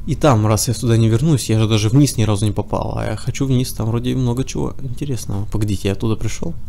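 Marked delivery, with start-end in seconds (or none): none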